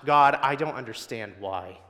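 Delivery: a pained cry of why, rising in force. Retold or imitated. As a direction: plead out loud, bewildered. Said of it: "is more of shouting out".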